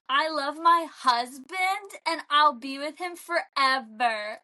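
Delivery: slow, put-on Brooklyn lilt; imitated Brooklyn accent. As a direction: copy a TikTok Brooklyn impression, stretching the words. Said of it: "watching TikToks of people being, like, d-, copying".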